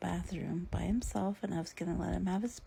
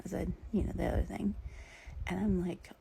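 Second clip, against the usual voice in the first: usual speaking voice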